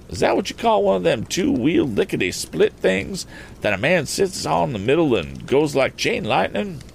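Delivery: high-pitched rustic puzzlement; country farmer. Acted. Farmer puzzling over the motorcycle, high rustic question. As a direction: ask it in a farmer's homespun voice.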